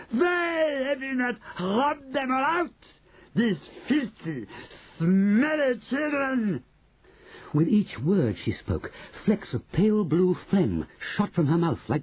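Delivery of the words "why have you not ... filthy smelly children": high screeching witch voice; screaming witch. Acted. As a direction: shriek it like an evil witch. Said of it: "she screamed".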